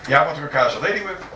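ordinary talk, nothing marked

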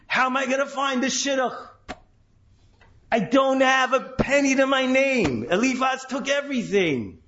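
worried Yaakov. Retold or imitated. Yaakov voiced in worry.

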